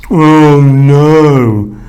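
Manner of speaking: loud, drawn-out dismayed groan. A narrator's reading. Jonah sinking in the sea, groaning in dismay. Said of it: "groaned Jonah".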